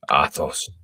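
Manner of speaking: plain reading voice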